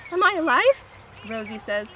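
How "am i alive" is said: high, loud, anxious question; small dog voice. Acted. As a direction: squeak it as a tiny dog. Fifi the small dog asks.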